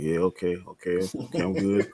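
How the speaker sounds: low, soft uneasy self-talk; murmuring okay to himself about the flies; quiet nervous mutter. Retold or imitated. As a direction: talk yourself down under your breath.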